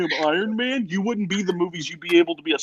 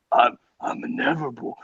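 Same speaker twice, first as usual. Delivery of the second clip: lightly voiced smug villain, lower; cocky Thanos; 7 semitones lower than usual. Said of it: "with the most cocky face possible and goes".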